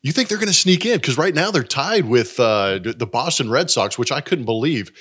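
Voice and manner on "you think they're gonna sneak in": high, doubting echo question; surprised. Real, not acted. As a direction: ask it back in disbelief. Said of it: "surprised echo of partner".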